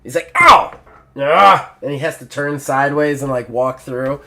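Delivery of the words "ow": sudden high loud yelp of pain; bull yelp. Acted. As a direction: yelp as horns hit the doorway. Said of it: "His horns actually bump it".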